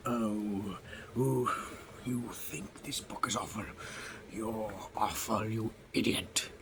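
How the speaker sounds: soft, slow sneering toy voice; mock the reviewer softly and slowly; snide toy. Funko Pop insults the reviewer, soft slow sneer.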